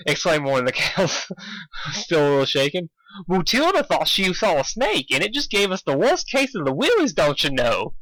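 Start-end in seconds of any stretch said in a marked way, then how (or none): 3.3-7.9 high cow voice, half Indian half Canadian; botched Canadian accent; failed Canadian accent. Acted. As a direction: attempt Canadian, slipping. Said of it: "more Indian than Canadian".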